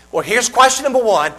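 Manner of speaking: ordinary talk, nothing marked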